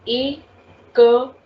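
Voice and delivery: "écrire" sounded out slowly, "ay ... kuh ... reer". Shown as a demonstration. Teacher giving a second example, "écrire" split into syllables. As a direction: break "écrire" into parts, opening on "ay". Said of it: "we need to give A sound".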